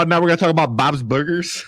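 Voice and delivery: hammed-up Chicago accent. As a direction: play up the Chicago vowels in Bob's. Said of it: "Chicago accent".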